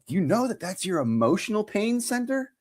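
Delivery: high hammed-up doctor voice; mock know-it-all. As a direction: play the earnest healer, high. Acupuncturist explains.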